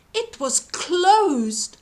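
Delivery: usual speaking voice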